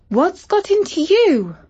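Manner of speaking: bewildered high-pitched question; high startled question. Acted. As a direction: ask the pony what's wrong, high and surprised. Girl scolding her startled pony.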